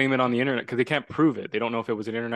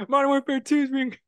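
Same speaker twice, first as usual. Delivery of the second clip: high-pitched imitation of an excited friend; high, giddy mock proclamation; mock friend's rant; 13 semitones higher than usual. Imitating friend ranting at his mom.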